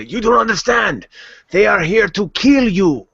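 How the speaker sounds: put-on Shrek accent. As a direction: warn them urgently in a Shrek brogue. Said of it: "Shrek has joined the show".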